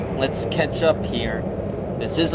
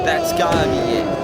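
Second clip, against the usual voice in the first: excited call. Acted. Near-fall call, high pitch.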